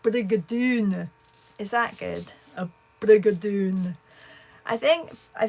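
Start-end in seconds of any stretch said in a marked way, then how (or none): 0.0-1.1 Brigadoon with a mock Scottish lilt, slow and louder; Scottish accent. Shown as a demonstration. Asked to say it Scottishly, a hammed-up Scottish accent. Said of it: "so you need to say it Scottishly". 2.5-4.0 Scottish accent. Shown as a demonstration. Trying again for the chat, a bolder mock Scottish accent. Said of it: "so you need to say it Scottishly".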